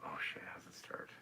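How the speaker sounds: whispered aside. Real, not acted. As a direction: whisper to yourself.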